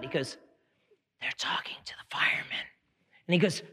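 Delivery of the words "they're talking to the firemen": put-on hushed whisper of a small kid; secretive little whisper. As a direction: whisper it like a child in hiding. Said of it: "Why are you whispering".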